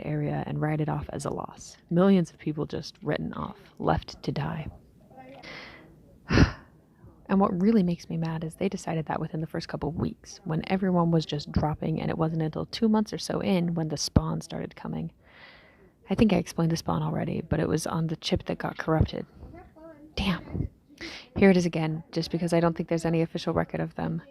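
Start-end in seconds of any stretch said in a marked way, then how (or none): 3.8-4.7 whispered. Acted. Bitter aside on abandonment. 20.2-20.7 whispered curse. Acted. Lost chip regret.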